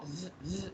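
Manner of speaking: ज़ said very softly, almost breathed; a soft, thin ज़; faint za sound. Shown as a demonstration. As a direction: let ज़ come out light and faint. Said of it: "ज़ाल के अंदर".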